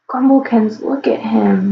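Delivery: ordinary delivery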